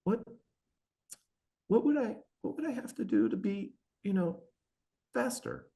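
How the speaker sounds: small timid voice; shy meek daughter. Retold or imitated. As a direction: ask it shyly, like a meek kid. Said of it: "she's real shy and meek".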